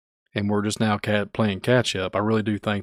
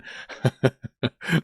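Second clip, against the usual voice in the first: rising laugh; 4 semitones higher than usual. Real, not acted. Laughing at own bit.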